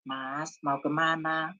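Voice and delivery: low, soft Javanese-style question; Javanese Solo manner. Shown as a demonstration. Preacher imitating a Solo Javanese asking, soft and low. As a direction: ask gently, as in Solo. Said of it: "misalnya orang Jawa".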